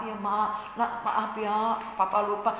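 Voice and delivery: soft, mild apology from a father; lightly voiced sorry papa; apologetic papa. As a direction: say sorry quietly to the child. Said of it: "Lalu papanya minta maaf".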